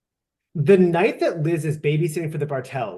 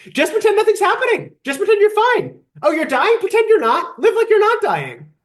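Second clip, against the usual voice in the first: much higher, sing-song fake cheer; sarcastic impression of Liz; mocking chirpy denial; 14 semitones higher, 3 dB louder than usual. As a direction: parrot the denial in a bright mock voice. Mocking Liz's denial advice.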